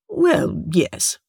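a light purr of agreement. A narrator's reading. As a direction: purr it, smoothly evasive. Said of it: "Brax purred".

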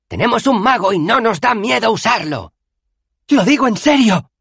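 high, loud warning yell; shouted threat. A narrator's reading. Bethan brandishes the wizard at the disciples, yelling high. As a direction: cry it out to scare attackers off. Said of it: "gritó Bethan".

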